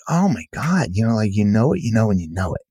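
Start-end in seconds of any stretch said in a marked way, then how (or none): 0.1-0.9 much higher, struck exclamation; sudden realization. Retold or imitated. Author realizing the lesson, high and struck. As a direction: it suddenly hits you. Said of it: "it, like, hit me like".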